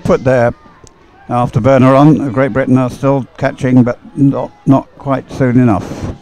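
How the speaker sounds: race commentary. Real, not acted. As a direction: call the finish as the boats close in, rising to it.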